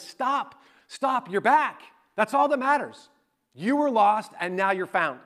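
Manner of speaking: a father cutting off his son's apology in relief; a hint of an overjoyed dad; joyful father. Father welcomes returning son.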